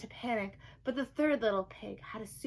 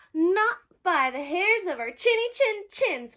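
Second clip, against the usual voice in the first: high, loud frightened pig squeal; pigs crying out; 9 semitones higher, 8 dB louder than usual. Acted. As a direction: cry it out like scared piglets. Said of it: "cried the pigs".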